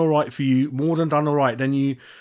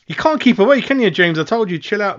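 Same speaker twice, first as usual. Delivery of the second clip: high, loud teasing call to James; playful gotcha, louder and higher; 9 semitones higher, 6 dB louder than usual. Real, not acted. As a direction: rib him for coming back.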